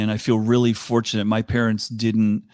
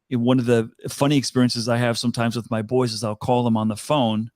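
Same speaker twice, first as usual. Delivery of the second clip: ordinary delivery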